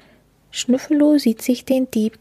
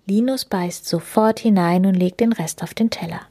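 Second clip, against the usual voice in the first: plain reading voice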